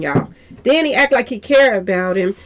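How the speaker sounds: ordinary delivery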